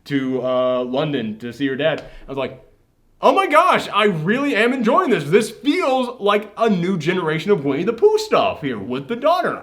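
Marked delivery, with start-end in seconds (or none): 3.2-9.6 higher-pitched, gushing reaction; retold excitement. Podcaster recalls enjoying the film, excited. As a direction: gush as if delightfully surprised.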